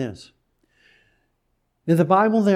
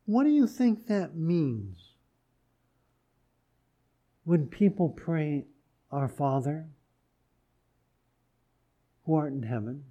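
soft, gentle question, a bit quieter; 3 semitones lower, 5 dB quieter than usual. Shown as a demonstration. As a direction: ask it softly, without pressure. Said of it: "just gently".

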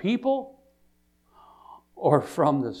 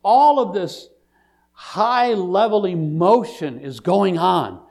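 emphatic, raised pulpit voice; full-force preaching; 4 semitones higher, 7 dB louder than usual. Real, not acted. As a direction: proclaim the scene with stretched key words.

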